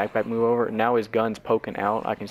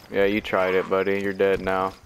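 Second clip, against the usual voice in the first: usual speaking voice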